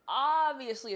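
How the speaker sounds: loud sarcastic obviously; loud sarcastic exasperation; loud exasperated obviously. Real, not acted. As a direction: belt it, mock exasperation. Late for bag check.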